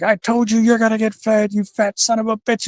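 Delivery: put-on scolding a misbehaving kid; high, furious mock reprimand. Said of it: "Vader's like".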